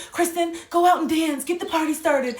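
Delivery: imitating relatives urging her onto the dance floor; mock pushy relatives. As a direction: egg her on to dance like family at a wedding.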